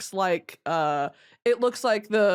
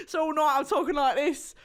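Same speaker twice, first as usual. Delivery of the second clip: high, hammed-up British accent; mock British accent; 8 semitones higher, slower than usual. Mocking a British guy at the bar, hammed-up British accent. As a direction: do a silly posh British accent. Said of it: "I have to mock British people".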